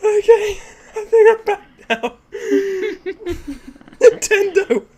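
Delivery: very high and loud, squealing with laughter; still laughing. Real, not acted. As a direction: try to talk through the giggles. Still cracking up after fit.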